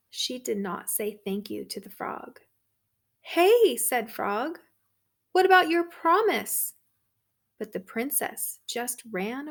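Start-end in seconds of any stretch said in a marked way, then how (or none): 3.2-6.8 high, loud call after her. Acted. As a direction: shout after the fleeing princess. Frog calls after fleeing princess.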